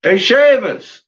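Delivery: loud, slow, high call; loud drawn-out call. Real, not acted. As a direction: call out across the house. Calling out to someone.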